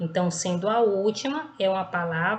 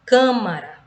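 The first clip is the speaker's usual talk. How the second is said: "câmara" with CÂ punched loud, "mara" falling away; stress on first syllable; 8 dB louder than usual. Shown as a demonstration. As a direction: hit "câ" loudly in "câmara". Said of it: "esse K é a sílaba tônica".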